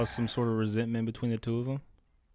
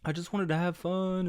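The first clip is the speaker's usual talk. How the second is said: imitating a whiny, tearful child, higher pitched; mock crying; 6 semitones higher than usual. Father imitating his daughter after losing her egg, whiny mock crying. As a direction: whine it out like an upset kid. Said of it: "starts crying".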